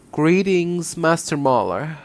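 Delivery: put-on same woman's character voice without the German accent; Von Karma. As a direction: drop the German accent, keep the haughty lilt. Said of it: "now do it without the German accent".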